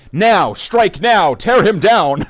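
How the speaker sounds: loud, slow mock battle cry; mock shout. Imitating rage watchers cheering his downfall, loud mock shout. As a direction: yell it like a gleeful hater.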